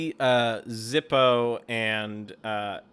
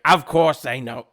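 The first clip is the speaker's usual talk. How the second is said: loud, slow kobold voice; game master slipping into the wrong voice for Zippo; 10 dB louder than usual. Acted. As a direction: boom it out slowly as the wrong character. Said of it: "that's not his voice".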